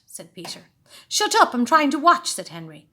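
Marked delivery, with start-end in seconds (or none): 1.1-2.3 rude snap. A narrator's reading. Henry snapping at brother.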